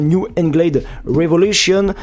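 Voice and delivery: "New England Revolution" drawn out in a put-on English accent; hamming up an English accent on the club name, slowly. Shown as a demonstration. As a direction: say the team name like a would-be Anglophone. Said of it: "désolé pour mon accent anglais catastrophique".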